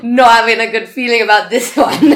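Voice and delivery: helpless amusement in the telling; laughing through words. Real, not acted. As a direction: laugh while getting the sentence out. Laughing as she retells refusal.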